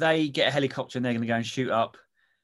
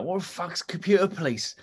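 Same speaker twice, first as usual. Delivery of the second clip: higher, baffled outburst; angry cockney president; 6 semitones higher than usual. Retold or imitated. The president hears about the computer police, angry and baffled. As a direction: splutter it like a red-faced boss. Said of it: "very red and very angry".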